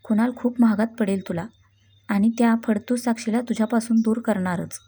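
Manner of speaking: usual speaking voice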